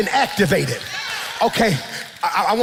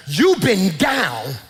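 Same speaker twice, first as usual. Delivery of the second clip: slow commanding; much slower than usual. Acted. God urging Michael to rise.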